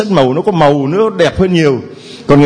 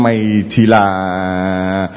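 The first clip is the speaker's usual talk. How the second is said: very slow and lower, each word drawn out; God thinking slowly aloud about a name; slow pondering God; 8 semitones lower, 3 dB quieter, much slower than usual. Acted. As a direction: muse over the name as if still deciding. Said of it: "Chúa đang suy nghĩ".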